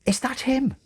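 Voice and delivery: high, eager little nun voice; chirpy nun. Acted. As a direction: squeak it out like a giddy nun. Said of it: "said Sister Mary".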